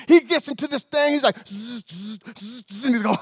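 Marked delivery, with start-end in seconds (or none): 1.5-2.8 imitated machine rumble, soft; mouth-made motor noise; engine buzz. Dad revving the loader.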